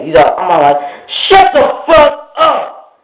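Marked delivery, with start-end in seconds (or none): 1.3-3.0 slow, high-pitched yell; retold angry shout. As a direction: act out shouting him down. Retold angry retort to friend.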